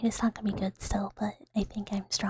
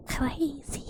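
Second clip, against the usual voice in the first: imitating Mame; 4 semitones higher than usual. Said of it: "I hear it in Mame's voice".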